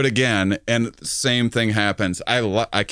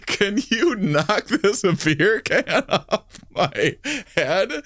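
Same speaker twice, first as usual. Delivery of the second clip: laughing through a quote; 7 semitones higher, slower than usual. Retold or imitated. Quoting Nick while cracking up.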